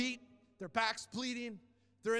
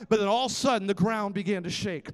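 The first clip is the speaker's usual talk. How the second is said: louder, building preacher's cry; preaching build; 13 dB louder than usual. Acted. Pastor telling the prison-quake story, building to a loud preaching cry. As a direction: raise it with each all of a sudden.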